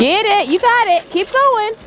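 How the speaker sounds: high, excited yells of encouragement; loud cheering on. Real, not acted. Onlooker urging a little skier to keep going, cheering loudly. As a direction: shout them on, pitched right up.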